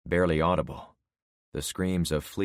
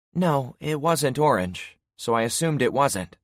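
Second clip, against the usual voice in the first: Penny's voice; 8 semitones higher, 4 dB louder than usual. Acted. Penny answers about the coin.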